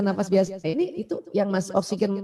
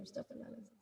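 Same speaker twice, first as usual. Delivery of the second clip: breathy whisper, much softer than the take before; whispered istighfar; 20 dB quieter, faster than usual. Shown as a demonstration. Teacher showing mothers how to whisper istighfar, whispered. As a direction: let the astagfirullahaladzim fade into a whisper. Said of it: "dilafazkan secara berbisik".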